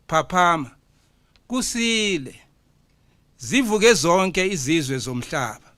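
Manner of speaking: poem recital. Acted. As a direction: proclaim each line like an imbongi to the hall.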